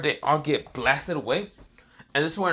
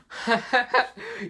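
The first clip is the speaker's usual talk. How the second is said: high, hammed-up villain cackle; villainous laugh; 12 semitones higher than usual. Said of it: "Orochimaru laughs and says".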